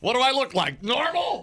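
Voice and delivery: high-pitched offended squeal; high mock indignant. Mock customer retort.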